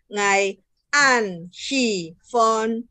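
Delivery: NGAI, AN, SHI, FON each falling separately; word-by-word falling tones on "ngai, an, shi, fon"; unchanged third tone. Shown as a demonstration. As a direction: keep "an" and "shi" both sinking. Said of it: "Ini annya tidak berubah".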